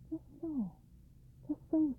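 very soft, high, gentle agreement; barely-voiced reassurance from an old man. A narrator's reading. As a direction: murmur it high and kindly. Old man soothes the princess.

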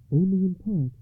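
lady's voice. Acted.

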